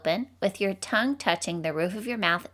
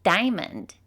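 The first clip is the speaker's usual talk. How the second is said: ordinary talk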